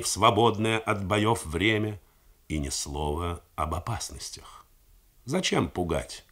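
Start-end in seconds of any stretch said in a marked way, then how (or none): 2.5-4.7 whispered aside, voice dropped; narrative whisper. A narrator's reading. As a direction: breathe it like a secret. Narrator drops to hush. (шёпотом, как тайну)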